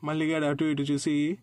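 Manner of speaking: ordinary delivery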